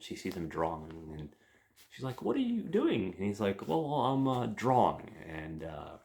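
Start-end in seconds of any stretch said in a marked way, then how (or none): 2.2-3.1 put-on high, bright-eyed girl's question; mock girlish voice, much higher; curious girl. Girl voiced in retold scene. 3.7-5.1 a hint of a nerdy guy, pitched higher; lightly voiced shy nerd caught drawing; higher mock nerd voice.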